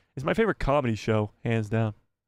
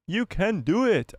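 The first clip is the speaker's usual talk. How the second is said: slow, higher, drawn-out cheering-on; mock pep talk, pitched up; playful encouragement; 6 semitones higher, slower than usual. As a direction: coax them with exaggerated, stretched words. Repeats encouragement in played-up voice.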